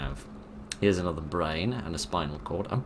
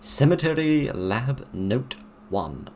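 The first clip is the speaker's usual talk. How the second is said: 1 formal reading; 6 semitones higher, 4 dB louder, slower than usual. Acted. Reading a document title.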